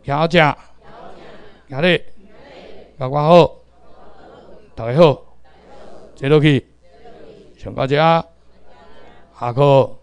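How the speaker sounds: loud class commands, pausing after each; read-along drill. Shown as a demonstration. Teacher leading the class in reading together, read-along drill. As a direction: call out each phrase for the class to repeat. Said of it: "来，咱斗阵念".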